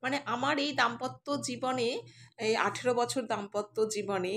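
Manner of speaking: ordinary talk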